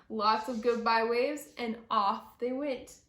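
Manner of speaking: ordinary delivery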